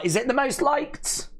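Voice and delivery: high rising, incredulous question; amazed question. Real, not acted. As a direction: ask it high, rising at the end. Asks, then answers own question.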